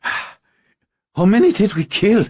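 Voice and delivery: high, slow, wounded demand. Acted. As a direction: press for the count while hurt. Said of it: "Larkin demanded".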